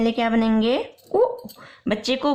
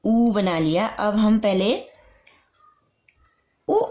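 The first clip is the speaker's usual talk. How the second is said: usual speaking voice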